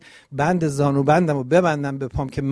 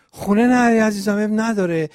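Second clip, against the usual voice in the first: mock pushy father; 6 semitones higher, 5 dB louder than usual.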